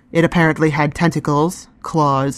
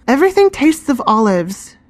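put-on higher, whiny complaint from a girl; a young girl grumbling, pitched up; complaining; 9 semitones higher, 3 dB louder than usual. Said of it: "Reka complained".

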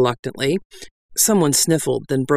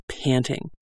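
ordinary delivery, nothing marked

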